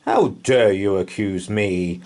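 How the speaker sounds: ordinary delivery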